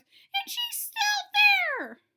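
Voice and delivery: very high, loud, slow squeal; baby bear shrieking that the girl is still there; loudest little voice. A narrator's reading. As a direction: drag out a tiny cub's shriek. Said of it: "in his loudest little voice".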